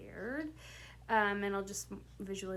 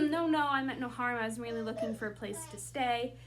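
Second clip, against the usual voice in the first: pleading line reading; 2 semitones higher, 4 dB louder, faster than usual. Shown as a demonstration. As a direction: blurt the denial, then explain quickly. Acting Maurice's plea again.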